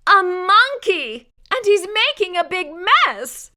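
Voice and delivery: high, loud alarmed exclamation; shocked nurse cry. A narrator's reading. Nurse shocked to find a monkey making a mess. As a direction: gasp it out in alarm.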